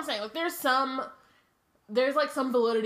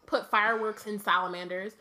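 ordinary delivery

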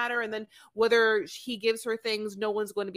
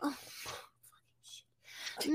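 unvoiced whispered curse; muttered curse in a whisper; whispered aside; 3 semitones higher, 12 dB quieter, much slower than usual. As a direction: whisper it under your breath. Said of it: "She's like".

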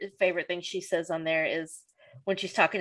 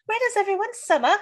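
put-on high lilting old-Hollywood Katharine Hepburn accent; a lightly voiced Katharine Hepburn accent; 13 semitones higher than usual. Friend quoting Phoebe's fake Katharine Hepburn accent. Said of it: "Doing this Katharine Hepburn voice".

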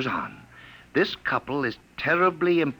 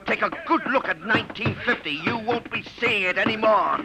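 gloating villain; 10 semitones higher than usual. Acted. Villain gloats before vanishing.

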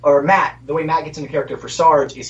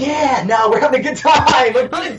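menacing cheerful voice; 7 semitones higher than usual. Retold or imitated. Mobster impression continued.

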